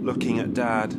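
ordinary delivery

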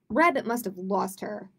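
plain reading voice

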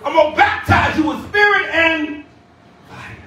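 punched, ringing pulpit declaration; preacher building to the last word; fervent preaching. Real, not acted. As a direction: proclaim it to the congregation. Preached line, then repeated low.

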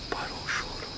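hushed whisper. Real, not acted. Alone by the grave after the crying stops, whisper. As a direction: breathe it, barely voiced.

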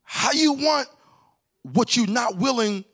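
high, ringing sermon delivery; preaching at full force. Real, not acted. Preacher driving the message to the congregation, full force. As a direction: lift it up and preach it.

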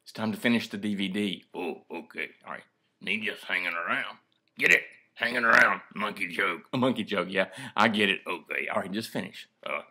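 ordinary delivery

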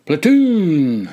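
high, loud parade-ground call; shouted command. A narrator's reading. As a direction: holler it out to the whole platoon. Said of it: "he shouted".

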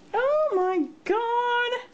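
high, slow drawn-out squeal; overwhelmed squeal. Real, not acted. Crafter overwhelmed by friend's gift, delighted squeal. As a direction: stretch it out high in amazement.

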